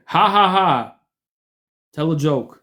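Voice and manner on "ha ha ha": loud, high mock ha-ha-ha; sarcastic fake laugh. Real, not acted. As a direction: spell out a derisive laugh, word by word.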